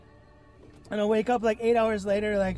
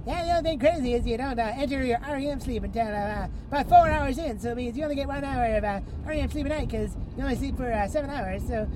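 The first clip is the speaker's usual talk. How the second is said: fast, higher mock Canadian accent; Canadian scientist; 3 semitones higher, faster than usual. Canadian scientist giving sleep results to the patient, mock Canadian accent. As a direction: rattle off the findings as a hammed-up Canadian. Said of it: "Canadian scientist".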